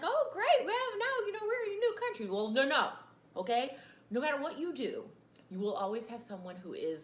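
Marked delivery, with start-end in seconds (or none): 0.0-2.2 hammed-up delight, much higher and louder; mock cheer. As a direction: play the naive optimist cheering. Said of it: "people would have been like".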